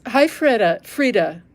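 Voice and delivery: ordinary talk, nothing marked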